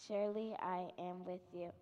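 ordinary talk, nothing marked

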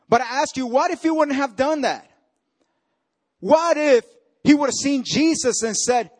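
preaching at full force. Acted. Preacher voicing a proud man's complaint to Jesus, loud and high.

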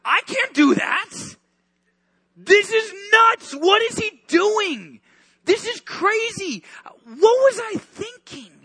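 high, slow, strained panic; panicked disciple. Acted. Peter panicking watching Jesus, high strained panic. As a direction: spiral into disbelief.

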